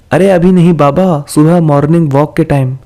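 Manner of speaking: usual speaking voice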